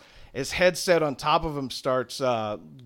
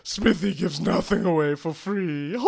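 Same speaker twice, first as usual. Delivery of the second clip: raised, theatrical villain voice; Smithy; 3 semitones higher than usual. Acted. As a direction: gloat with false apology. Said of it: "and he goes".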